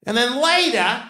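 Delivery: hammed-up teacher building to a shout; fifth grade sex ed teacher. Teacher explains the diagram to the fifth grade, high and building to a shout. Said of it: "She's like".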